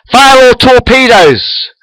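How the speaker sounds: high, loud, drawn-out battle command; shouted order. A narrator's reading. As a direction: shout it slowly across the bridge. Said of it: "shouts Chekhov".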